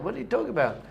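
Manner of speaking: high baffled protest; freaked-out cub. Acted. Cub freaking out at his lion face, baffled. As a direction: blurt it in mock alarm.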